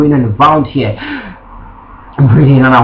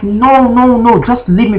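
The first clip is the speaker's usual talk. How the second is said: high-pitched insistent refusal; retold protest; 9 semitones higher, slower than usual. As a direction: act out protesting as you get pushed away. Acts out protest while being pushed.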